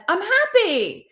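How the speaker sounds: high, loud, smiling exclamation; happy. Acted. The smile tells the mind it's happy, cheerful. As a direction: sing out a big cheerful smile. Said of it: "smiling, and that says".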